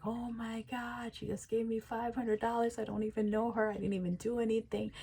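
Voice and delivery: high, soft amazement; stunned disbelief. Acted. You alone after getting five hundred dollars, quietly stunned. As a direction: marvel to yourself in a high, hushed voice.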